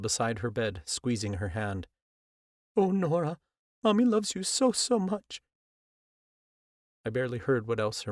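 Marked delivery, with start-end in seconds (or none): 2.8-5.4 much higher, louder pleading mother voice; a mother's love pouring out at the bedside; mother kneels by her dying daughter, high and tearful. A narrator's reading.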